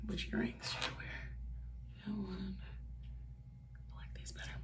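hushed, voiceless side question; whispered aside. Real, not acted. Whispering an aside about which earrings to wear. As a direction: drop to a whisper off-mic.

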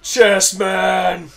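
loud high proclaiming cry; triumphant shout. Real, not acted. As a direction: announce the name like a champion. Shouting his wrestler's name.

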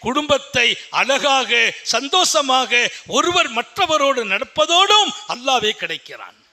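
fervent preaching. Acted. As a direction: proclaim it to the congregation. Preaching at full force. (உரத்த பிரசங்க குரல்)

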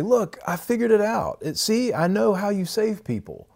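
mock boastful voice, pitched well up; hammed-up self-satisfied believer; smug know-it-all. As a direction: lampoon someone proud of cracking the puzzle. Mocking a proud self-righteous claim.

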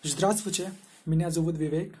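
ordinary talk

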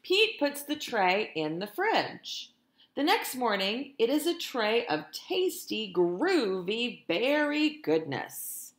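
lively, sing-song storytime reading; animated picture-book narration; reading to children. A narrator's reading. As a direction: read it like a teacher to her class. Teacher reads picture book aloud.

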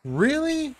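high, incredulous squeak of disbelief; surprised. Real, not acted. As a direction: shoot way up in disbelief. Reacting to the fish.

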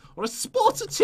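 lightly put-on English accent, high pitched; a mock posh English accent. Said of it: "get my English accent on".

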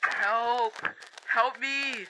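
shouting out for help; calling for help. Acted. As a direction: call out into the dark. Lost in the dark, calling.

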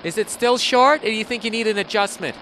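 high, loud mocking inquiry; mock concern. Stylist phoning about the haircut, sarcastic put-on concern. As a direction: pitch it up like a fussing caller. Mocking imagined follow-up phone call.

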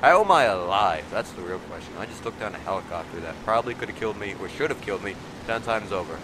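ordinary talk, nothing marked

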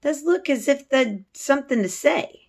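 teacher voicing a maid in a read-aloud; Martha's Yorkshire voice. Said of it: "she asked".

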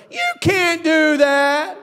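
a drawn-out mock taunt; mocking doubter voice. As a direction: mimic the voices that doubt you. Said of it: "voices that tell me".

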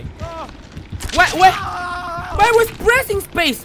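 high, loud disbelieving shout; shocked shout. Real, not acted. Streamer outraged at the game, high shout. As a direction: yell in outrage.

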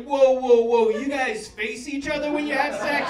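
loud, slow shocked "whoa, whoa"; confused and disgusted. Retold or imitated. Dog Leo shocked watching the couple face each other, loud and slow. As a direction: voice the dog's baffled disgust. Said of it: "so confused and disgusted".

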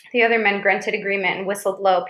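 plain reading voice

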